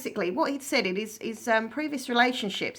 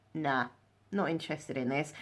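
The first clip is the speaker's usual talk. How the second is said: dismissive refusal; 5 semitones lower, 8 dB quieter than usual. Retold or imitated. Retold cool refusal of his kiss.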